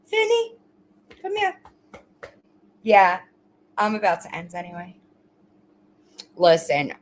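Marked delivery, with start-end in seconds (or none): 0.1-1.6 high coaxing call to the dog; sing-song come here to the dog; pet talk. Real, not acted. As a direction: coo the dog over, pitched way up. Calling the pet over.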